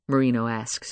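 plain reading voice

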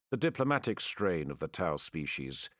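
ordinary delivery

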